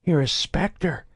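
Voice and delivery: high, strained captive voice mumbling; Grotto, mumbling. A narrator's reading. Captive mumbles to his interrogator, high and strained. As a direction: mumble it as the terrified prisoner. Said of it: "he mumbled".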